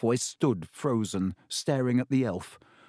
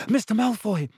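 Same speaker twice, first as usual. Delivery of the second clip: breathless higher shout; gasped call; 9 semitones higher, 4 dB louder than usual. Acted. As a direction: call out after running. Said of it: "he gasped".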